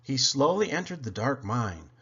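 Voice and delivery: ordinary delivery, nothing marked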